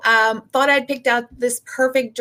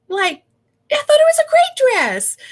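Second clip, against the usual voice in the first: much higher, louder outraged protest; indignant disbelief; 4 dB louder than usual. Retold or imitated. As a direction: squeal in wounded disbelief. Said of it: "in my head, I'm like".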